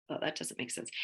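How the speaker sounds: breathy muttered self-correction; whispered aside. Real, not acted. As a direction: whisper it under your breath.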